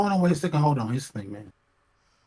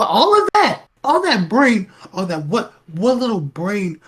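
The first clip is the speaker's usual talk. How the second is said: incredulous rant; 5 semitones higher, 6 dB louder than usual. Real, not acted. Ranting about her brain power.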